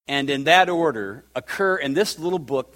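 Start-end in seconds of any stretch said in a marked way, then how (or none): none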